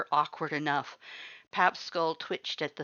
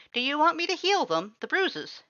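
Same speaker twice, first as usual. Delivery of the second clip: much higher, eager skeleton voice; Papyrus voice; 12 semitones higher, 4 dB louder than usual. A narrator's reading. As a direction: offer help brightly. Papyrus offers to heal.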